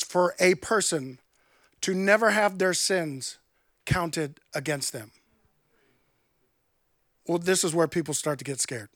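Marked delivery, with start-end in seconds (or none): none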